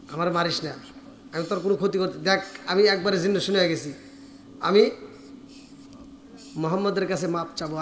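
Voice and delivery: soft, low, quick begging; weak pleading. Acted. Exhausted Haresa asks to go seek forgiveness, weak low pleading. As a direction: beg feebly, voice sunk low. Worn-out Haresa begs Abu Jahl.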